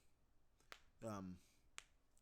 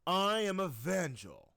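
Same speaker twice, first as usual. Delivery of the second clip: Evangel voice; 7 dB louder than usual. Shown as a demonstration. First take of Evangel introducing himself, loud and proud. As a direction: announce yourself grandly. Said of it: "Take one for Evangel".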